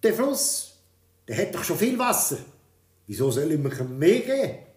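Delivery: king questions the odd wish; puzzled king. A narrator's reading. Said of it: "hat gefragt". (lauter, verwunderter König)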